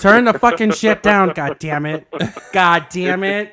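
loud, cursing mock tantrum; punched-up profane complaint; mock outburst. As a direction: curse it out loudly, playing at being fed up.

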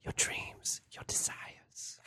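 breathy whisper. Retold or imitated. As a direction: whisper it seductively. Whispered movie line.

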